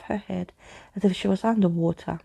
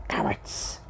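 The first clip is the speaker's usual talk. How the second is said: plain reading voice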